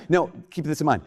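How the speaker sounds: ordinary delivery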